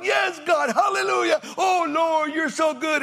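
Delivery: high shouted hallelujah, hammed-up; preacher parodying the congregation's loud praise; mock showy praise. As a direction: overdo the spiritual worshipper. Mocking showy praise at altar.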